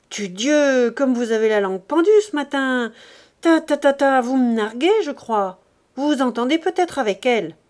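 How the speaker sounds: loud, sneering ta ta ta; angry, mocking husband. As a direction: jeer at the wife. Grandet mocks and accuses. (mari moqueur et furieux)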